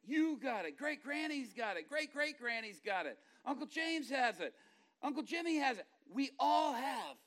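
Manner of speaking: loud, high run of examples, building; excited listing. Retold or imitated. Boys excitedly list relatives sharing nose.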